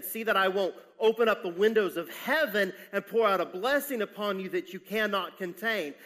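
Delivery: loud, emphatic pulpit delivery; fervent preaching. Real, not acted. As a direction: proclaim the promise with full conviction.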